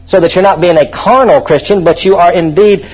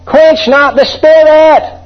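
high, slow, emphatic verse; preaching at full force; 10 semitones higher, much slower than usual. Acted. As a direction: proclaim it slowly and high. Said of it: "What's he talking about?".

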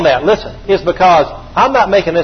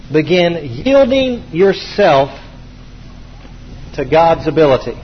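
slower still, each word apart; very slow dictation; 2 semitones lower than usual. Shown as a demonstration. Preacher repeating the key slower for note-takers. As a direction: let every word sink in. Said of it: "repeated for dictation".

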